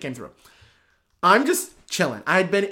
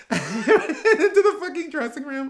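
laughing; 12 semitones higher, slower than usual. Real, not acted. Laughing while telling.

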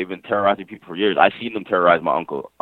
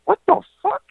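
high, incredulous yelp of disbelief; retelling his stunned reaction to the police; shocked outburst. Retold or imitated. Retold shock at cops' accusation.

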